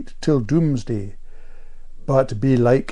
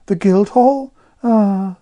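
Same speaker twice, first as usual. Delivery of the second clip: high, louder sceptical condescension; 9 semitones higher, 6 dB louder, slower than usual. A narrator's reading. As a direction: query it loftily, then a slighting "ah". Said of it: "deliberately chosen the most offensive".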